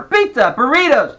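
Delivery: put-on high, loud bickering kids; shouting match. A narrator's reading. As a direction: fire the shouts back and forth.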